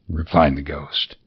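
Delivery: usual speaking voice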